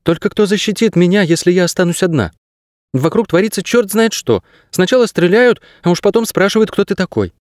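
put-on high-pitched girl's voice, protesting; high, worried girl character; worried young girl.

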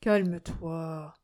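slow, drawn-out calming words; gentle soothing. A narrator's reading. Priest calms the raging old man, gently. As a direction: soothe him gently and slowly. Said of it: "lui dit doucement le père Yakim".

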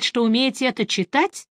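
usual speaking voice

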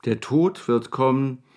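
slow, heavy recital of a gloomy poem; reciting verses. Acted. Wetschorowski recites ominous verses to the anxious Maljanow, slow and solemn. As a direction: intone the lines slowly, like a dark prophecy. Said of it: "Dass es sich um Verse handelte".

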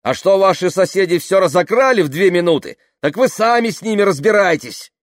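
high, loud scolding; angry retort. A narrator's reading. Tamara blaming the crowd's own neighbours, angry retort. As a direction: throw the blame back at them.

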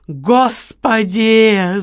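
slow, loud anguished cry to heaven; loud despairing wail; yelled. Acted. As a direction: howl it at the ceiling. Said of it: "заорал Лёня".

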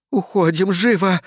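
high, urgent command to flee; shouted order. A narrator's reading. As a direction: hurry them out, pitched high. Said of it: "кричит Кристи". (тревожная команда на высоких нотах)

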